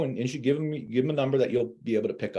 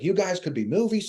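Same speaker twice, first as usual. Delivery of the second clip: a higher, thrilled quote of the wife with only a hint of a New Jersey accent; excited New Jersey wife; 9 semitones higher, 3 dB louder than usual. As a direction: gush like the delighted wife, lightly imitated. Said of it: "She had this New Jersey accent".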